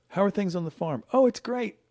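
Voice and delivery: high-pitched mock-cheerful small talk; breezy cover-up, pitched well up; falsely upbeat answer. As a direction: put on an "everything's fine" voice. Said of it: "like, the right answer".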